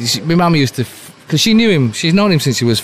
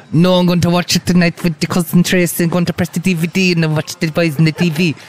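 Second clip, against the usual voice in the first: put-on higher, fussy old Irish mum; mum's voice; 5 semitones higher than usual. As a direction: imitate his mother explaining the DVD player. Son quoting mum about watching DVD.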